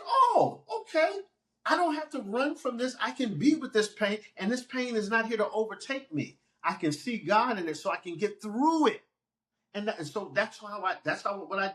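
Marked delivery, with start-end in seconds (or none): 0.1-9.0 surprised realization. Retold or imitated. Retelling his realization aloud.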